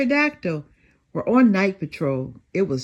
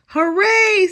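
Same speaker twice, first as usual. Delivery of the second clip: loud high happy cheer; a big joyful storybook cheer; hooray; 12 semitones higher, 8 dB louder than usual. A narrator's reading. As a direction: cheer with delight. Detective cheers, shoe fixed.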